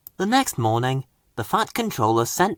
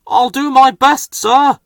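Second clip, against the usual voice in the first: higher, louder eager reply; brave Harvey; 11 semitones higher, 5 dB louder than usual. A narrator's reading. Crane engine bravely answering his boss, higher and louder. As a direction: answer boldly, ready to help. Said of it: "Harvey said bravely".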